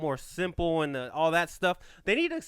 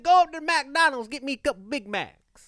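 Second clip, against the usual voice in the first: higher, louder imitation of grandma; grandma ordering Big Macs; 9 semitones higher, 5 dB louder than usual. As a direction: play grandma sending him for food. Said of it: "She'd be like".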